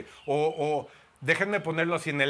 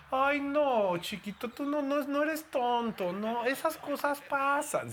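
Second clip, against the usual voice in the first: much higher, slow and cooing; mock-tender girlfriend consoling her boyfriend; sweet girlfriend voice; 11 semitones higher, slower than usual. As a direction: coo it like a doting girlfriend.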